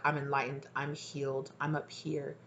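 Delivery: hushed mock-serene claim; self-styled enlightened one. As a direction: imitate someone softly posing as healed. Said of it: "try to come off very much so".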